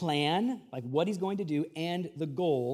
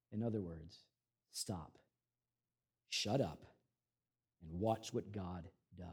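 hushed; 12 semitones lower, 13 dB quieter than usual. Real, not acted. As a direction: drop to a near whisper. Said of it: "soft emphatic aside".

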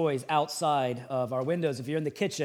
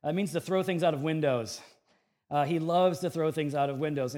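ordinary talk, nothing marked

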